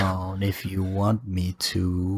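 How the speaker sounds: drawn-out, exaggeratedly slow words; slowed-down speech. Shown as a demonstration. Teasing a friend on a laggy call, slowed right down. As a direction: stretch every word out as if for a lagging listener. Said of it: "I can slow down".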